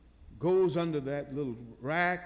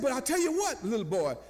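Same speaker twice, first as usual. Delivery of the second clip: high-pitched retold eagerness; imitated boy's excitement, pitched up; excited little boy; 8 semitones higher, faster than usual. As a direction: lightly voice an eager child. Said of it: "he's excited now".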